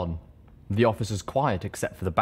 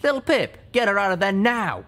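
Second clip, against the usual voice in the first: higher, louder urgent command; urgent order; 12 semitones higher, 5 dB louder than usual. Acted. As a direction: yell the order.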